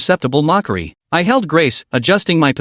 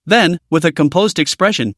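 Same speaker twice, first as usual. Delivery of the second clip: usual speaking voice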